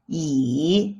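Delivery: "yi" dipping low, then up; falling-rising tone. Shown as a demonstration. Teacher leads repeat drill, dipping yi. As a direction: sink then lift a long "yi". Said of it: "The third tone is the falling rising tone".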